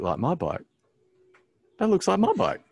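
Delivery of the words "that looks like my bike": high, louder jolt of recognition; alarmed realisation. Retold or imitated. Man spots his own bike in the dark, alarmed realisation. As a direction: voice leaps up as it dawns on you.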